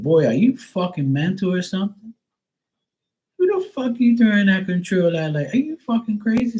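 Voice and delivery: high, heated scolding; retold outburst at a disrespectful teen; angry yell at nephew. Retelling yelling at nephew.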